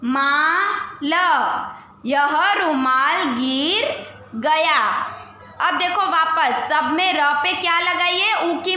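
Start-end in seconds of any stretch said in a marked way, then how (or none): 0.1-4.8 Guru drawn out slowly, each syllable clear; slow reading aloud. Shown as a demonstration. Teacher modelling a phrase to young learners, slow. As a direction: spell it out in sound for the kids. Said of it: "तो चलो लगाओ".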